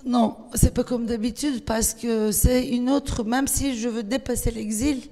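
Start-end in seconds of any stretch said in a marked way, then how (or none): none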